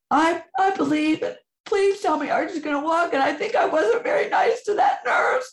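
high, crying, half laughing; tearful outpouring. Real, not acted. Mother reliving her desperate plea, crying and high. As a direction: pour it all out through tears.

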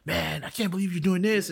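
lightly imitating a friend's excited praise; friends hyping him up. Friends calling him up, imitated as hyping, excited. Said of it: "hyping me up".

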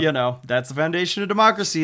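a knowing, smiling aside; smile and a wink. Retold or imitated. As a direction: wink at the listener as you say it. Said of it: "the guy says with, like, a, a smile and a wink".

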